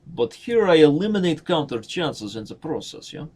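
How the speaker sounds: high, loud mock Russian accent. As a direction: belt it out in a playful Russian accent. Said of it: "That is my Russian accent".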